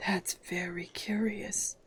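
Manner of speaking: long-breath whisper; whispered slowly. Acted. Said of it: "drew a long breath and said".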